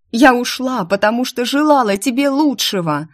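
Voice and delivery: high, loud yell; screaming. Acted. Heroine screaming her reason at the demon, loud and high. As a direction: shout it straight into his face. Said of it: "заорала я в лицо демона".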